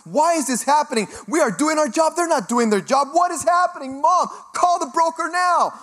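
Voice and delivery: high, loud ranting, building to a yell; retold negative panic. Broker losing a top client rants to mom, panicking. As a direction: act out spiralling into hysterical complaint. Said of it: "I start getting negative".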